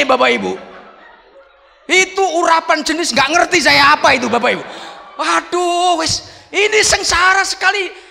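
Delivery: very high, loud retold wail of complaint; exasperated outburst. Pastor lamenting his ordeal to the congregation, hammed-up exasperation. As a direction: act out being at wits' end, loudly.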